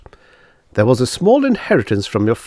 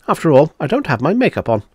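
usual speaking voice

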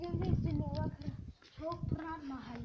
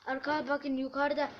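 excited; 9 dB louder than usual. Real, not acted. Spooky discovery of bloody hand.

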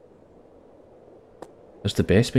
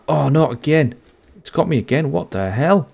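raised, incredulous groan of frustration; exasperated surprise; 7 semitones higher, 3 dB louder than usual. Real, not acted. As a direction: pitch jumps up, louder, fed-up disbelief. Trapped again by the game.

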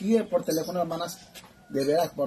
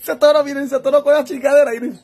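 shrieking laughter-cry; 9 semitones higher, 12 dB louder, faster than usual. Real, not acted. Shows viewers stuck object.

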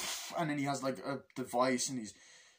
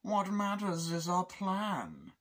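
high-pitched, drawn-out villain voice; slow, lilting film-villain impression; Bane; 6 semitones higher, slower than usual. Masked villain addressing his captors, high slow menacing impression. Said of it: "Tom Hardy's still a threatening villain".

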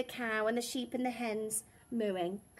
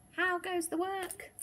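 calling farmer; 8 semitones higher than usual. A narrator's reading. Farmer keeps calling to duck.

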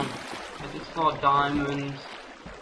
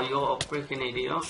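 usual speaking voice

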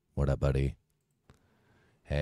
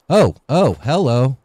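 surprised shout; 9 semitones higher, 11 dB louder, slower than usual. Real, not acted. Spots enemy suddenly.

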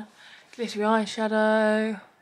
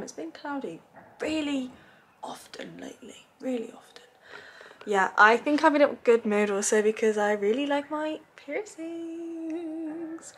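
whispering; 4 semitones higher than usual. Real, not acted. Sudden whisper about weather.

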